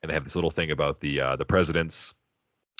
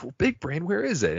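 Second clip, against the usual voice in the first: much higher, clueless quizzical voice; dumb high voice; 12 semitones higher than usual. Retold or imitated. Podcaster voicing Fry dumbly asking where Big Brain is, high clueless voice. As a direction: ask it like a total airhead. Fry's dumb question retold.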